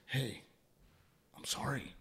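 very soft, halting apology; gentle apology. Retold or imitated. Someone coming back to apologise, very soft and disarming. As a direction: drop right down in volume, pausing before the apology.

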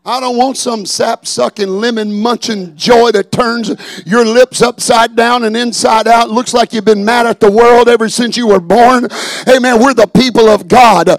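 high, loud scornful preaching; mocking preaching. Preacher mocking sour joy, loud and high.

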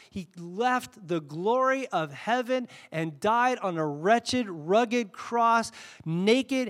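fervent preacher proclaiming; impassioned preaching. Real, not acted. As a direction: preach it with building fervour, drawing out key words.

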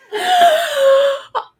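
high, loud gasp of disbelief; a sharp gasp that breaks into laughter; shocked laughing gasp. Real, not acted.

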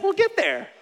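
high, lightly voiced wife; mock sweet reassurance; wife soothing her lost husband, in a high voice; wife's calm reply. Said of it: "she's like".

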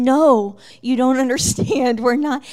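loud, urgent protest pulling away; a retold loud no while yanking her arm free; protesting loudly. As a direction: insist loudly you are not pastors. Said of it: "and I'm saying".

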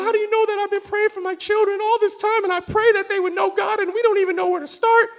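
high, imitated tearful woman; retold weeping. Weeping mother asks pastor how he knew, retold weeping. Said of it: "just starts weeping".

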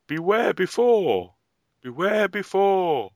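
long, high, loud drawn-out call; military warning shout. Acted. Explaining a military warning to co-host, drawn-out shouted call. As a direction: call a warning to soldiers down the line. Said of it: "comes from the military".

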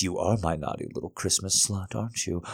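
normal talking voice

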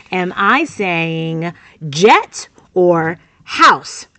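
very slow, each word drawn out; a patient coach stretching out the choice; slow choice question. Shown as a demonstration. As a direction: offer the two words slowly and clearly. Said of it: "this is some live coaching for you".

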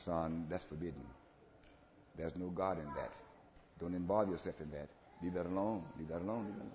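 low, soft, gentle counsel; hushed fatherly correction; still small voice. Acted. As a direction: say it gently and quietly, well down in pitch. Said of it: "in a still small voice".

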